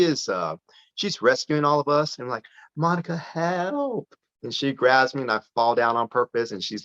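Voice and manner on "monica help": higher, slow, hammed-up cry for help; mock plea for help. Dad to daughter while she rescues him, slow higher mock plea for help. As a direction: drag out a playful plea to be rescued.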